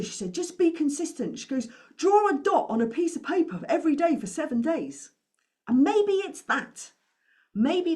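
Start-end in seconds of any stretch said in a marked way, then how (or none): 0.3-1.4 higher, louder imitation of Paula's accent; imitating Paula's accent. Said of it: "forgive the accent". 2.0-8.0 higher, louder imitation of Paula's accent; imitating Paula's accent. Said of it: "forgive the accent".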